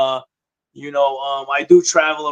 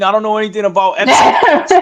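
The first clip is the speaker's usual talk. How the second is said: laughing; 5 semitones higher, 4 dB louder than usual. Real, not acted. Joking denial, laughing.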